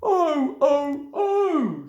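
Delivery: high moaning oh oh oh; high whiny truck chorus; wailing trucks. A narrator's reading. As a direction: groan like sulky trucks. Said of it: "said the trucks".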